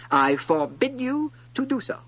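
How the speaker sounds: high, loud royal ban; forbidding monarch. A narrator's reading. King forbidding a yawn, high and commanding. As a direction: lay down the law imperiously. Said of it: "the monarch said to him".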